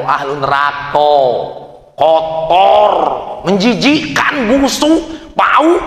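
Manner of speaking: makin tinggi dan keras tiap kata; mencela diri, memuncak. Retold or imitated. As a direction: pemabuk menghina dirinya sendiri makin keras. Pemabuk mencela dirinya sendiri. (menghina diri dengan suara memuncak)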